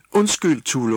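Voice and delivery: high, loud flustered shout; shouted apology. A narrator's reading. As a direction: yell sorry in a fluster. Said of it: "råbte han". (råbt undskyldning)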